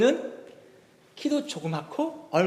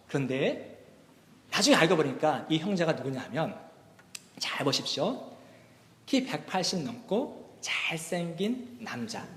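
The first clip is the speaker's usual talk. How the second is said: ordinary talk, nothing marked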